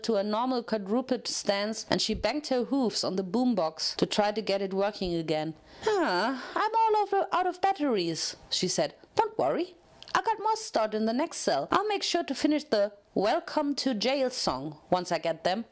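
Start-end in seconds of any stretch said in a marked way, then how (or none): 5.9-15.6 chirpy, higher pony voice, full of cheer; eager cellmate chattering at a new arrival, chirpy and higher; bubbly Pinkie Pie. Acted. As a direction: bounce every word like an overexcited party pony.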